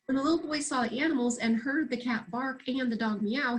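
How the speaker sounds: ordinary delivery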